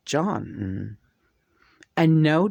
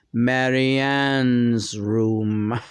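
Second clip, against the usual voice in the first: slow, lower, drawn-out line; John's channeled voice; 4 semitones lower, much slower than usual. Retold or imitated. Remembering the first channel, John's slow, lower voice. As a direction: let the spirit speak, slowly. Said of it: "very first channel".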